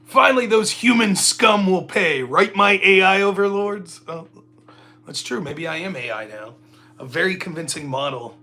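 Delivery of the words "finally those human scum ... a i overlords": loud, higher mock villain gloating; hammed-up evil AI. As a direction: gloat like a cartoon machine villain. Mock evil robot loyal to AI overlords.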